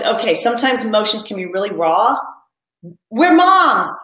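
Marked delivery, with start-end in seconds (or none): 3.1-3.9 loud and high, rallying the room; reassuring a tearful mom, loud proud rally call; proud declaration. Real, not acted. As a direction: shout it proudly to lift them up.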